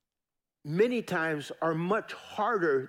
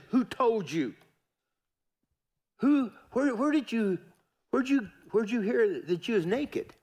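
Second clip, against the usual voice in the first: higher, insistent questioning voice of God; God questioning Adam; 5 semitones higher than usual. Acted. God pressing Adam, high and searching.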